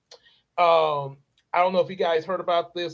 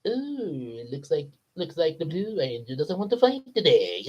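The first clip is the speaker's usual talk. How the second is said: softer, mocking peanut villain; Mister Peanut taunting; 4 dB quieter than usual. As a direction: tease the scared Ranger. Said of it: "He's like".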